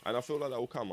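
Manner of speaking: ordinary talk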